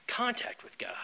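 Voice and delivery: slow whisper, almost unvoiced. Real, not acted. Speaker to fellow members, revealing the one answer, slow whisper. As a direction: let the answer fall to a hushed slow whisper. Key message whispered to room.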